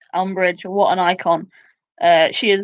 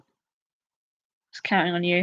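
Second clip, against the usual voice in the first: slow, soft mock letdown, stretching it out; mock disappointed; 2 semitones lower, 6 dB quieter, slower than usual. To the losing player, mock disappointed and slow. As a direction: sigh it out like a betrayed fan.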